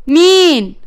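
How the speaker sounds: high, loud surprised call; puzzled who's there. Acted. Narges calling through the door at a loud knock, surprised. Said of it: "فقالت باستغراب".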